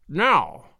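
suddenly serious, understated; severe. A narrator's reading. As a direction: turn businesslike and stern. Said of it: "turning suddenly from lively to severe".

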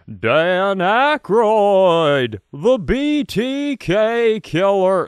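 high, very slow booming announcer; SNL announcer voice. Retold or imitated. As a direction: stretch it like a TV promo announcer. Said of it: "announcer voice".

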